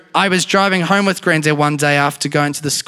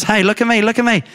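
higher, a light imitation of a kid begging to be watched; lightly voiced child pleading for the camera; a hint of a needy kid calling out; attention-seeking kid; 8 semitones higher, faster than usual. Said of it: "one of those kids that just keeps going to the camera".

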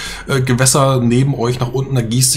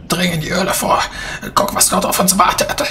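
raised, breathy command; whispered necromancer order; 7 semitones higher than usual. Acted. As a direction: hiss it.

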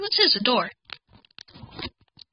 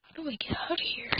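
anxious whisper; 6 semitones higher, 12 dB quieter than usual. Real, not acted. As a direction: whisper it, lost and scared. Scared whisper.

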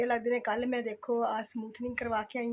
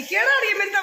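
dramatic loud aunty; 9 semitones higher, 7 dB louder, faster than usual. Real, not acted. Teasing friend loudly.

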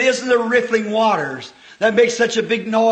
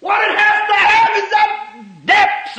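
high, urgent pulpit cry; impassioned preaching shout; 11 semitones higher, 4 dB louder than usual. Real, not acted. Preacher telling believers what they must have, high impassioned shout. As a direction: burst out high and forceful.

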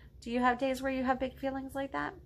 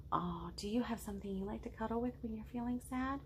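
ordinary delivery, nothing marked

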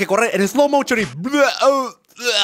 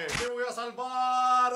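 slow, higher, quiet slow-motion line; 5 semitones higher, 9 dB quieter, much slower than usual. Retold or imitated. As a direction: stretch every syllable like a movie slow-mo. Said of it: "el tipo va corriendo así como que".

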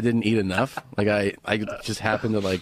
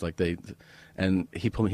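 ordinary talk

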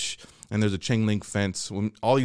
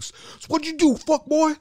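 inmate bully. Retold or imitated. Inmate interrogating kids.

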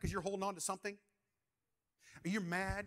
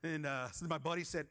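ordinary delivery

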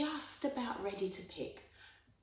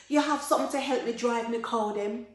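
raised, loud appeal; urgent request; 6 semitones higher, 8 dB louder than usual. Acted. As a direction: ask a friend for help, voice lifted. Takuma asks for help with cattle.

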